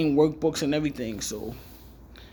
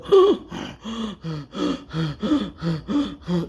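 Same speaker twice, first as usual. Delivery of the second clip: gasp for breath. Retold or imitated. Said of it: "trying to get my breath together".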